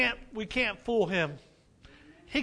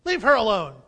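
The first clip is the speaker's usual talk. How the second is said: firm rebuke; 8 dB louder than usual. Retold or imitated. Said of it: "Jesus says".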